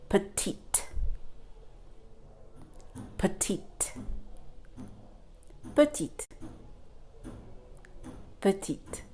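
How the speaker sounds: petite ending on a crisp T, "puh-TEET"; silent final E. Shown as a demonstration. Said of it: "This is the word".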